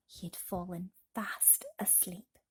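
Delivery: slow, breathless whisper as sleep comes; hushed whisper. A narrator's reading. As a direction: let the words drift off into a whisper. Falling asleep at chapter end.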